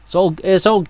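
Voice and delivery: usual speaking voice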